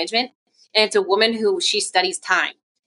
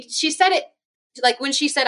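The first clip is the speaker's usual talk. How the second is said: ordinary talk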